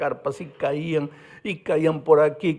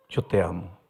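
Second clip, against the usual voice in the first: soft, lower, sincere; tender; 7 semitones lower, 4 dB quieter than usual. Retold or imitated. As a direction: say it gently, from the heart. Repeats love to the boy.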